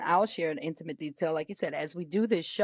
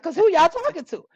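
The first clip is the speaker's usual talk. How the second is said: much higher and louder indignant outburst; mock scolding mom; 11 semitones higher, 10 dB louder than usual. Mother blasting her boisterous kids, mock indignant. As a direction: scold the kids like they're out of line.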